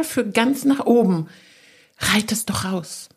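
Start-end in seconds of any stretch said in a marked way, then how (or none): 2.0-3.1 soft, slow whisper. Acted. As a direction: breathe it as a secret nudge. Whispered aside about the horse.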